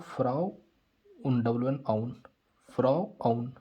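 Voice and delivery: फ्राउ and आउन said apart with pauses; broken into sounds. Shown as a demonstration. Teacher showing how he reads the word, फ्राउ and आउन separately. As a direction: spell it out in parts, फ्राउ, then आउन. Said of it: "मैं इसको फ्राउन ही पढ़ रहा हूँ".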